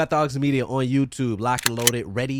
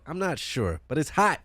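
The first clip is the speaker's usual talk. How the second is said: normal talking voice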